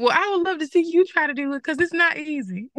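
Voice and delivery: high, fast sassy challenge; sassy comeback. Retold or imitated. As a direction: toss it back with attitude. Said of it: "And I was like".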